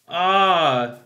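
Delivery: ordinary talk, nothing marked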